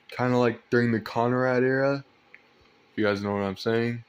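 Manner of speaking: normal talking voice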